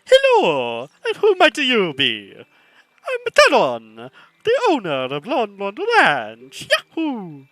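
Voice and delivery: loud, high, drawn-out hammed-up Italian accent; mock Italian accent. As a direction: do Mario with a big, slow cartoon Italian accent. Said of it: "That was an impeccable Italian accent".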